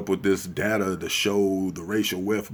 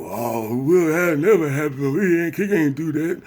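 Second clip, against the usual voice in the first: high, loud whiny mock voice of a sellout; 8 semitones higher, 4 dB louder than usual. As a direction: sneer it like a sellout denying everything. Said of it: "here comes some chicken pegging, chop mouth".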